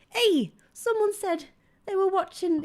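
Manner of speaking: usual speaking voice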